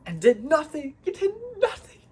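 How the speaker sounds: high, loud, laughing exasperation; exasperated squeal breaking into laughter; mock outraged yell. Real, not acted. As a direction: cry out in comic disbelief. Mock outrage at a game boss.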